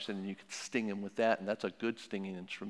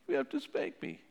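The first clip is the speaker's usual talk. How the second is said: put-on much higher little child voice; child's voice. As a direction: answer like a small kid caught out. Child answering parent in role-play.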